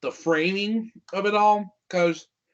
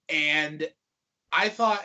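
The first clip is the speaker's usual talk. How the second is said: ordinary talk, nothing marked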